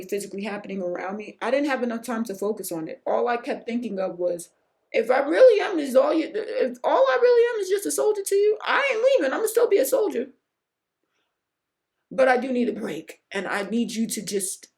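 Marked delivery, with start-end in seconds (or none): 4.9-10.3 high, loud, laughing declaration of loyalty; defiant soldier vow. Retold or imitated. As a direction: shout it out like a stubborn soldier.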